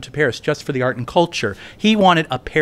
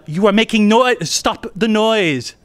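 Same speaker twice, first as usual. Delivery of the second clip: annoyed French student; 6 semitones higher, slower than usual. Retold or imitated.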